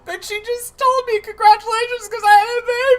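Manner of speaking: very high, loud cracking wail; mock sobbing anchor. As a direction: squeal it out as if bawling. Playing weepy Anderson Cooper.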